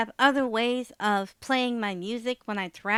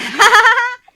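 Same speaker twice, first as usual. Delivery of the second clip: high burst of laughter; loud laugh; 12 semitones higher, 18 dB louder than usual. Real, not acted. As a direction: howl with laughter.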